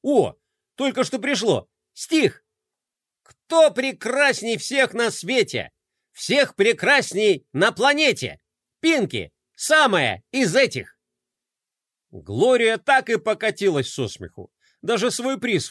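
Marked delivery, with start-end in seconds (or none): 0.0-11.0 hammed-up poem recital. Fly reciting a silly poem.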